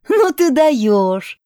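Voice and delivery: high, drawn-out amused disbelief; acted mirth, stretched and high; through laughter. A narrator's reading. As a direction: exclaim slowly and loudly, as if amused. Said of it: "проговорила она сквозь смех".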